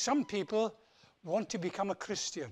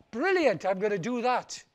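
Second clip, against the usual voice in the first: delighted; 4 semitones higher, 9 dB louder than usual. Acted. Tumba delighted.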